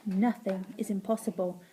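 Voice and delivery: a touch of quiet reassurance; Slime. A narrator's reading. Slime friend answers the wailing boy, low and soft. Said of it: "replied Slime".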